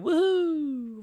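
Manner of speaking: high celebratory whoop; woohoo cheer. Pretend. Cheering about celebrating with Norwegian flags, high whoop. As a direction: cheer like waving a flag.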